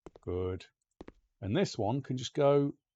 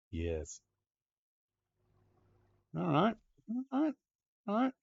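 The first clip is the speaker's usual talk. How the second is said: ordinary talk, nothing marked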